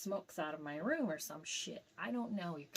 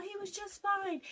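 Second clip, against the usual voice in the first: "He was just fine" said much higher in panicked disbelief; "He was just fine" pitched up high, still reeling; shocked disbelief; 13 semitones higher than usual. Retold or imitated. Daughter insisting to police that dad was fine, high disbelief. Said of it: "as I said, "He was just fine".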